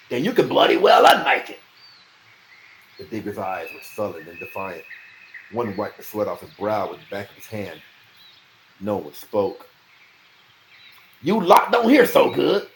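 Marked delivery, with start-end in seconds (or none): 0.1-1.6 loud, higher, contemptuous; loud threat. A narrator's reading. Bennis threatening the diggers, loud and scornful. 11.2-12.7 high, loud mocking sneer; sneering taunt. A narrator's reading. Bennis sneering at the silent diggers, loud. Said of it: "said Bennis".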